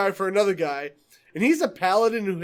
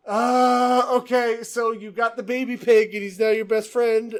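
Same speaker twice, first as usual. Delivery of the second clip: long exasperated 'uh', raised voice; annoyed game master; 5 semitones higher, 5 dB louder than usual. Retold or imitated. Player imitating the DM recapping the baby pig, exasperated. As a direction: imitate a game master grudgingly summing up nonsense. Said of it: "he's so annoyed by half the shit".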